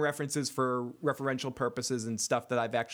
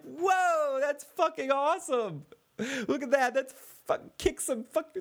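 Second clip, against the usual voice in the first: amazed fan yelling; 12 semitones higher, slower than usual. Retold or imitated. Fan reliving the scene, shouting in awe at Syrio's fight. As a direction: cheer like a stunned viewer watching a fight. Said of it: "You're like".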